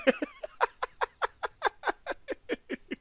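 long, very high-pitched cackle; squealing laugh that runs on and on. Real, not acted. Gamer cracks up at slot machine word, high cackle.

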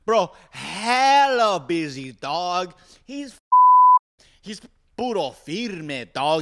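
hammed-up Mexican accent, stretching hella. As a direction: lay on a thick Mexican accent, bro. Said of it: "doing it a Mexican accent".

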